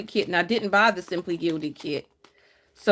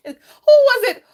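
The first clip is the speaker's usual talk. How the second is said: laughing squeal; 10 dB louder than usual. Real, not acted. Laughing, teasing a viewer.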